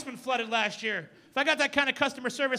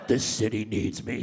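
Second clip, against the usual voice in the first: very low, slow growl of a brooding hero. Retold or imitated. Comic quoting Batman's line to the crowd in a very low slow growl.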